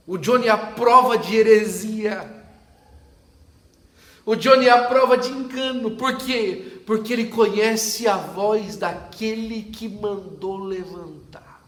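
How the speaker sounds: loud, slow, soaring preaching; preaching at full force. Acted. Pastor preaching to the congregation, loud and rising. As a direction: proclaim it to the whole church.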